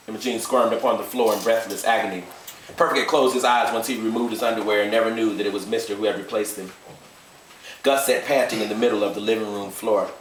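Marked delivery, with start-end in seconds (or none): none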